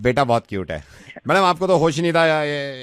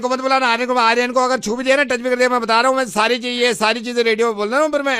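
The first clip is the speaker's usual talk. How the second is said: mock threat; 11 semitones higher than usual. Prank host threatens mother over son.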